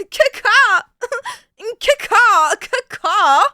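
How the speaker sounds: high, loud cawing through laughter; giggling high bird call; raven caw. Pretend.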